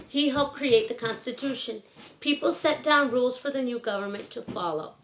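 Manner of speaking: clear, gentle storybook read-aloud; reading to children. Acted. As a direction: read it like bedtime story time for kids. Reads picture book to children.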